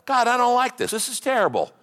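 high-pitched complaint to God; lamenting. Acted. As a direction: protest to God that you are wronged. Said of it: "That's called lamenting".

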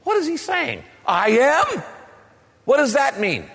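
high-pitched incredulous questions; baffled questioning. Acted. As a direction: puzzle aloud, voice shooting up. Acts out puzzled reaction to "I am.

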